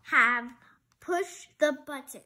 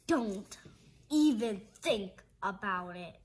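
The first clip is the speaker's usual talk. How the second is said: low stern warning; 8 semitones lower than usual. Acted. Larry warns seriously.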